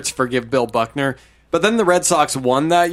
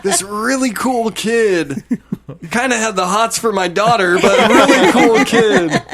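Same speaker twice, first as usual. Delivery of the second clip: laughing mock Buckner; 7 semitones higher, 4 dB louder than usual.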